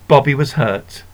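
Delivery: plain reading voice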